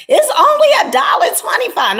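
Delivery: high, loud scoffing mock voice; hammed-up dismissive shout; mocking viewer. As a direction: scoff at the cheap price like a snob. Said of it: "some of you guys may look at it like".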